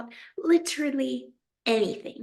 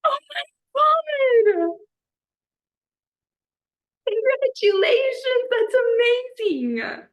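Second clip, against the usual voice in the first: high, loud, stretched-out joyful cry; squealing congratulations at the big news; excited congratulations; 7 dB louder than usual. Real, not acted. Friend announces acceptance, surprise joy.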